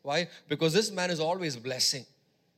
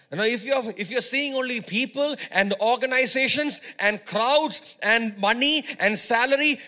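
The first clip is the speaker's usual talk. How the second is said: higher, louder climax of the sermon; full-force preaching; 5 semitones higher, 5 dB louder than usual. Acted. Preacher urging the church to see Christ, full-force climax. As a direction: call them out, building to a peak.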